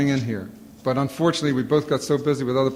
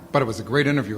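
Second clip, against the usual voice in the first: ordinary talk, nothing marked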